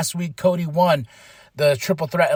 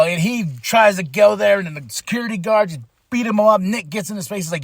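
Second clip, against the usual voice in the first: ordinary delivery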